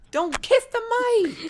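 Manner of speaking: high, loud, slow cry of no; alarmed shout. Real, not acted. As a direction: yell to stop her, alarmed. Panicked shout at sister.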